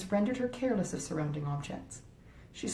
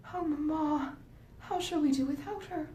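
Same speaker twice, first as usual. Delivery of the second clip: put-on higher, earnest young girl's voice, a touch dismayed; young Marianne; 9 semitones higher than usual. A narrator's reading. Marianne pleading to her mother about losing her sister, higher and earnest. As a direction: lift the voice into a passionate teenage daughter.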